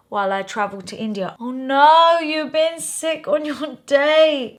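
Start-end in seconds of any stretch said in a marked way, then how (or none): 1.4-4.6 loud "oh no", then slow with a laugh; sympathetic laugh. Real, not acted. Vlogger reacting to a reader sick on her date, sympathetic laughter. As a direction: wince and laugh at the same time.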